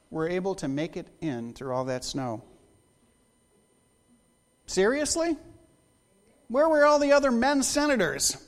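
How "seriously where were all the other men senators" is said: mock disbelief. Mock outrage at absent men.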